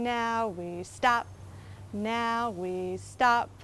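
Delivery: slowed-down sung "Now we stop" with rising tune; slow it right down so they freeze. Shown as a demonstration. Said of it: "Let's do it again".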